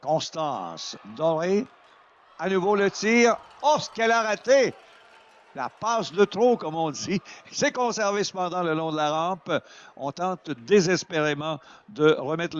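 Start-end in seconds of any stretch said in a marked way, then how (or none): 2.4-6.6 excited. Real, not acted. Near miss on shot.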